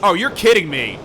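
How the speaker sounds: loud, rising play-by-play shout; excited call. Real, not acted. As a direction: yell it as the upset unfolds. Wild finish, commentator erupts.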